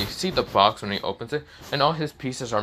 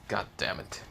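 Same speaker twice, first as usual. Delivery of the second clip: exasperated; 9 dB quieter than usual. Acted. Exasperated aside after sigh.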